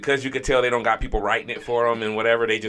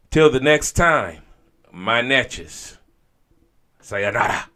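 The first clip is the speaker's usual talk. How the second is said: loud sign-off; 4 semitones higher, 8 dB louder, slower than usual. Acted. Radio sign-off.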